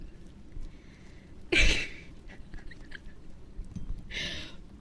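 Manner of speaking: long run of laughter; real laugh. Real, not acted. Streamer joking about her pink fairy model, long laugh. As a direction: laugh on and on, helplessly.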